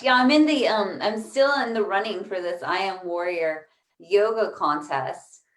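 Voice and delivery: ordinary talk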